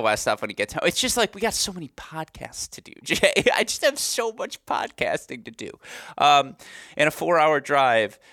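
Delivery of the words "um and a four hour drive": ordinary delivery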